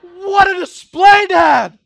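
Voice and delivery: loud, slow, laughing sarcastic cry; hammed-up shout of thanks to dad; mock grateful yell. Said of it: "they do not yell".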